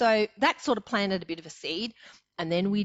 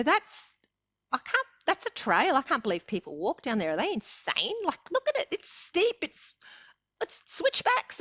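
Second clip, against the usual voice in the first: much higher, shocked exclamations; incredulous disbelief; 10 semitones higher than usual. Retold or imitated. To her daughter at the rim, high incredulous disbelief. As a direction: squeal it like the trail looks impossible.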